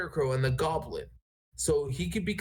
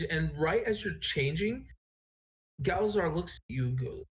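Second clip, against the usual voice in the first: ordinary talk, nothing marked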